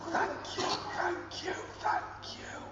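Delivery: breathless whispered thank you. Acted. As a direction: hush it out gratefully.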